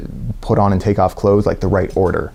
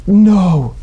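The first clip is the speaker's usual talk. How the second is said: high dismayed no; 10 semitones higher, 3 dB louder than usual. Retold or imitated. Dreading going under again.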